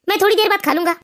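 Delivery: weary husband. Acted. Husband tells his wife he is not hungry, low and weary. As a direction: push the food away, worn down.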